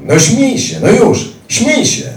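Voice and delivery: shrill furious command to laugh; furious taunting yell. Retold or imitated. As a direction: scream it at him. Attacker screams demands.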